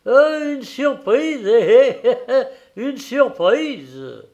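high, loud, slow forced chuckle; nervous laugh. A narrator's reading. Bargeman laughing nervously to his wife, forced chuckle. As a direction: laugh to cover embarrassment. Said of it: "Le marinier riait jusqu'aux oreilles".

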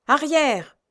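yelled. A narrator's reading. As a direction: bark the order back at them. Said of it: "hurle le vieux marin".

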